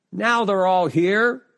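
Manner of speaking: announcing call. Acted. Cousin announces the last arrival at the family gathering, calling out. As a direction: sing it out to the gathering, high and drawn out.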